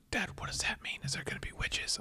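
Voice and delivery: put-on hushed, high little-girl question; imitating daughter. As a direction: voice a child asking very softly. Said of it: "And she goes".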